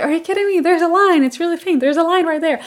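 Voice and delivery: high, loud, excited insisting; excited disbelief. Retold or imitated. To her husband, pointing at the faint line, high and excited. Arguing with husband over test.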